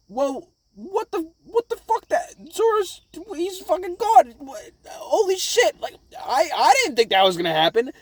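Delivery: high, stunned disbelief; shocked crew. Retold or imitated. Crew watching Zoro vanish, high shocked voice. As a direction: gape at it in squeaky shock.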